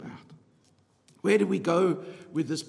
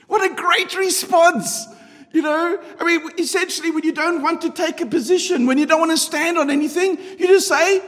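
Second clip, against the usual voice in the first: much higher and louder, slowed, marvelling; excited mock outrage; 8 semitones higher, 7 dB louder than usual. Real, not acted. Preacher marvelling to congregation at evasive answer, excited high voice. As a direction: pitch up and hammer out the joke.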